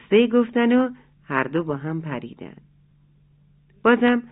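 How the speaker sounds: ordinary delivery, nothing marked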